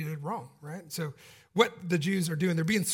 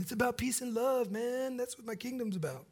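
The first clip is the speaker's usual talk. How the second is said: higher, laid-back hippie voice; dreamy hippie impression, softer at the end; mellow hippie; 6 semitones higher, 6 dB quieter than usual. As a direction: drift through it like a stoned peace-and-love hippie. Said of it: "like a hippie, you know, coming in and saying".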